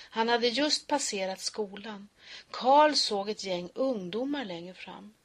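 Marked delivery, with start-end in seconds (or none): none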